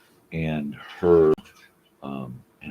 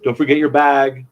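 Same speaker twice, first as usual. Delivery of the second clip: high, loud sing-song goodbye; goodbye at the door; 10 semitones higher, 10 dB louder than usual. Real, not acted. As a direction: call out a fond farewell. Saying goodbye to family leaving.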